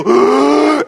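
high, drawn-in gasp in midair; long mock gasp. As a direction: suck in air in mock terror. Acts the pause in the air.

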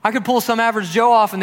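loud, acted indignation at close friends; frustrated. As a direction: reproach them, voice lifting. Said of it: "a spillover of his frustration with the disciples".

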